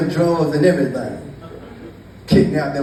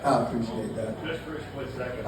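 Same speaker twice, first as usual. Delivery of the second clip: quieter repeat; 4 semitones lower, 11 dB quieter, much slower than usual. Real, not acted. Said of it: "thanking the laughing woman".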